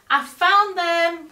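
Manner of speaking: loud, high, slow call to a far-off sheriff; shouted. A narrator's reading. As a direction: call out across the desert. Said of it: "Cowboy Baby shouted to Sheriff Pa".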